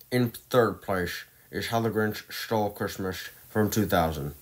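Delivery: a light put-on Grinch voice, announcing the ranking; Jim Carrey Grinch voice. Movie ranker announces third place to viewers, lightly voicing the Grinch. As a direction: slip into a sly cartoon-villain Grinch for the announcement. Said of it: "that was pretty good for an accent of what Jim Carrey does".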